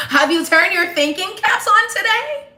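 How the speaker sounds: high, loud singsong teacher voice; bright mock teacher question, high and loud. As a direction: ask it like a chirpy teacher to kids.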